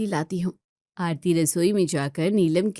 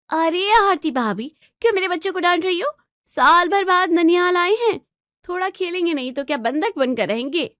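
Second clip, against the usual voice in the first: loud, higher, rapid-fire rebuke; angry scolding; 8 semitones higher, 5 dB louder than usual. Acted. Neelam shouting at Aarti for telling off her children, angry rebuke. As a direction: snap back hard to defend the kids.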